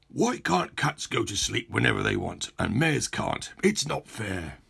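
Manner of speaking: lightly voiced grumpy mayor, sulking; a mild petulant whine about fairness; whiny mayor. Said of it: "started getting cross again".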